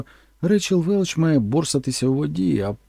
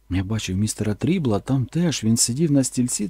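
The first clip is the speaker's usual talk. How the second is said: plain reading voice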